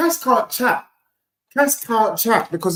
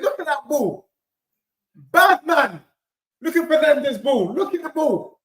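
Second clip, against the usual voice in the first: usual speaking voice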